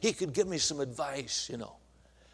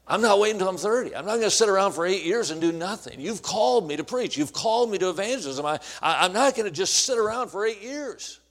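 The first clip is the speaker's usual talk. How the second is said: loud, insistent rant to God; indignant protest; 3 semitones higher, 6 dB louder than usual. Retold or imitated. Young preacher arguing with God while driving, loud indignant protest. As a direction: argue it forcefully, hammering each refusal.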